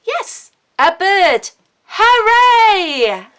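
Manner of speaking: high, loud hooray; big joyful whoop; excited cheer. Acted. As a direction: cheer it for the children. Excited children's reading.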